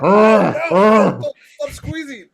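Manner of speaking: high, loud pained whining monster; whiny monster voice. Acted. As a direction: whine like a creature with a toothache. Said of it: "Sounds like he's got a toothache".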